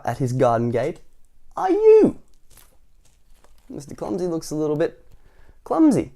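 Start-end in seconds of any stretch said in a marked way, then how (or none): 1.6-2.2 high, loud falling question; horrified splutter. A narrator's reading. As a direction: shriek the question in horror. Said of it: "spluttered Mister Fussy, looking in horror".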